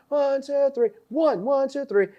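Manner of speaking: high-pitched teasing count; mock drill count. Teasing friend with a high count during push-ups. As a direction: crank out the count mockingly.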